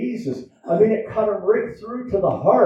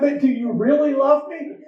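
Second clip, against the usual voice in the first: perturbed, raised pitch; 8 semitones higher than usual. Retold or imitated. Husband recalling the wife's third question, mock exasperated. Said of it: "she says to me the third time".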